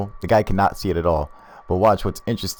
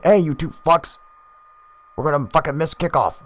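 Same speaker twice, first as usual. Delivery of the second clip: mock irritated call; 10 semitones higher than usual. Other dude calling them in for kickoff, mock irritated. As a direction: shout over to them, annoyed.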